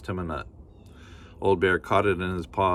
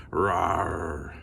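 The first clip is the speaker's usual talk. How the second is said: bear growl; 3 semitones lower than usual. Acted.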